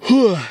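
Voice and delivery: long loud weary sigh; exhausted sigh. Real, not acted. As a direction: let out a big tired breath. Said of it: "I'm pretty exhausted".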